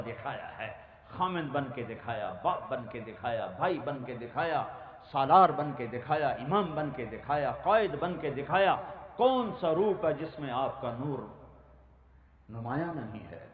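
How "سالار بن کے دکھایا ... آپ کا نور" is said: ordinary talk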